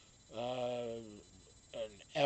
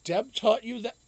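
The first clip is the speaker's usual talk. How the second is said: higher, louder incredulous question; can't believe Deb taught the dog this; amazed disbelief; 10 semitones higher, 9 dB louder than usual. Real, not acted. Owner asking the dog in disbelief.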